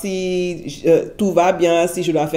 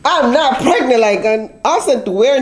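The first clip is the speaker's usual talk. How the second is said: high, loud disbelieving protest; incredulous denial; 5 semitones higher, 4 dB louder, slower than usual. Retold or imitated. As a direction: insist you're not pregnant, amazed. Retelling denial to doctor. (incrédule, plus aigu et plus fort)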